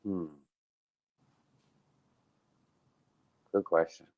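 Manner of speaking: musing aloud; slow thoughtful hmm; thinking hmm. Real, not acted. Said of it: "as he's, he's thinking".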